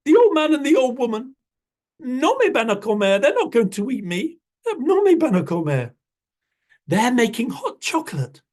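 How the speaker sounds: a clever bunny bargaining brightly; little rabbit. Acted. Rabbit tempting jaguar with hot chocolate, high and quick.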